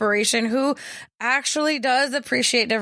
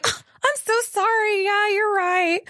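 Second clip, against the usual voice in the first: high, put-on meek apology; mock giggly apology; 9 semitones higher than usual. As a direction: imitate a simpering, giggly apology, pitched way up. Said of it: "supposed to giggle and say".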